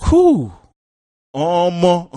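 very high, loud exclamation; stunned yell. Acted. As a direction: cry out in sheer disbelief. Stunned reaction to played clip.

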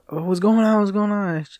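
a worried dad bursting outside; alarmed dad. Retold or imitated. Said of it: "He's like".

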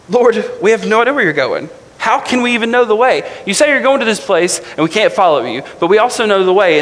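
high, agitated disciple's voice; freaking out. Retold or imitated. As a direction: pitch up with panicked confusion. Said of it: "he has kind of a freak out moment".

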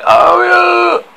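high, loud yell. A narrator's reading. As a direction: shout it out with glee.

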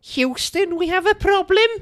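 very high, loud, botched Scouse accent; over-pitched Scouse key phrase; high-pitched Liverpudlian attempt. Shown as a demonstration. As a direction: squeak out a bad Liverpool accent. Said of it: "Imagine that, but less high pitch".

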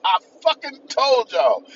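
high loud mock outburst, slow at the end; high hammed-up I told you. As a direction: yell it as an indignant rapper.

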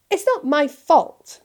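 put-on a sulky boy snapping back; retorting. A narrator's reading. Draco defending himself to his father, whiny. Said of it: "retorted Draco".

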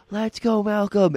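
high-pitched, drawn-out cheer; retold fan yell. Fan cheering on Malcolm at the anthem, mock yell. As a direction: re-enact a shout across a dead stadium. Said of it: "if you just yelled at the right time".

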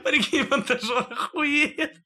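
words squeezed out through laughter; high, slow laughing delivery. Real, not acted. Joke about the podcast editor.

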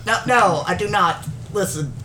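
usual speaking voice

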